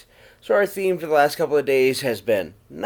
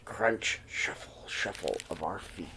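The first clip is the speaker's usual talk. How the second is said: much softer, fading down to a whisper; hushed footsteps; 6 semitones lower, 13 dB quieter than usual. A narrator's reading. Narrator hushing the class to hear the snowy footsteps, softly fading. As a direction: let the crunch and shuffle sink almost to a whisper.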